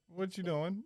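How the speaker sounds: high-pitched mock mother's voice; imitated mama. Mama reacting to weird phone call, imitated high voice. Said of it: "called your mama up and she'd be like".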